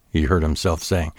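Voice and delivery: ordinary delivery, nothing marked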